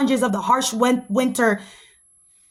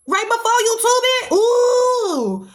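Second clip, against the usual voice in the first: excited shout; 9 semitones higher, much slower than usual. Real, not acted. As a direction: shout it out in delight. Excited outburst.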